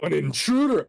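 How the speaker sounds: startled gasp. Acted. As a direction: shout it like you've found an intruder. Startled librarian discovering intruder.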